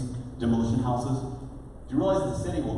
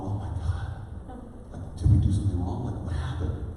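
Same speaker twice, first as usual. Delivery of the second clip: worried hush; 6 semitones lower, 5 dB quieter than usual. Retold or imitated. To the crying single mom at his door, worried hushed voice. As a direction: whisper it like you fear you did wrong.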